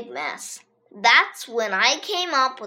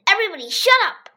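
fast, urgent hush; hurried hush; 8 semitones higher, 4 dB louder, much faster than usual. A narrator's reading. Ryan hushes everyone, fast and urgent. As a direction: rush it out, urgent. Warning others.

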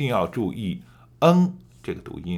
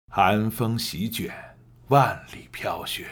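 story narration; slower than usual. Shown as a demonstration. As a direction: draw listeners into the tale. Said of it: "我可以给你做一个演示".

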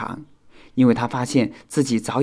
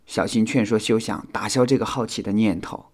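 plain reading voice

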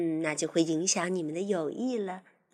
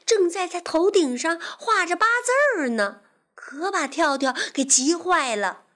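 higher, lively storytelling; children's story narration; 8 semitones higher, 5 dB louder than usual. A narrator's reading. Teacher telling children about a pesky fly, animated narration. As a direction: tell it vividly for little listeners.

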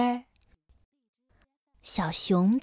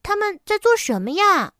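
put-on high, louder child's question; curious cub, pitched up and bouncy; giggly little bear; 9 semitones higher, 5 dB louder than usual. A narrator's reading. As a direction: ask with a cub's eager delight. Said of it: "小熊咯咯地笑着问".

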